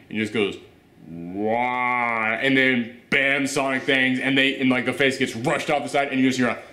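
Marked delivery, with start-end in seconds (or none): 1.1-2.3 drawn-out, low-key "waaah"; slow-motion cry. Retold or imitated. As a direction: stretch one syllable like slowed film. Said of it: "it's in slow motion".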